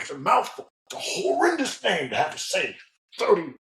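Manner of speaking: imitating an irritated officer griping; exasperated officer. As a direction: whine about saying the name again and again. Annoyed officer quoted.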